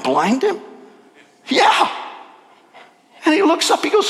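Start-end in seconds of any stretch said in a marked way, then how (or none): none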